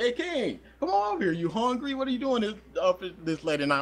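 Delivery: high, friendly call; friends calling out. Retold or imitated. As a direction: call him over, high and eager. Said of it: "They were like".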